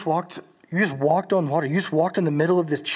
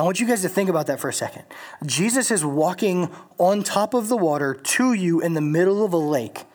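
ordinary talk

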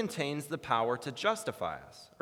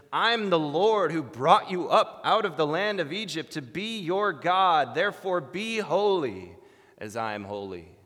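louder, higher, weighty proclamation; solemn divine command; 5 semitones higher, 7 dB louder than usual. Retold or imitated. God giving Israel the command in Leviticus, proclaiming. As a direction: proclaim it as God's own decree.

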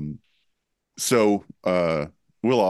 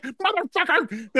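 exasperated Will. Retold or imitated. Friend reacting to a face caress, high exasperated outburst. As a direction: squeal out a baffled complaint. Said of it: "he's like".

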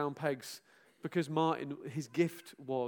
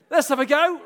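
mock surgeon; 9 semitones higher, 13 dB louder than usual. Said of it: "if you're a surgeon".

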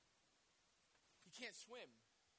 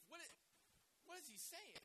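puzzled; 10 semitones higher, 4 dB quieter than usual. Real, not acted. Preacher puzzled by the quote.

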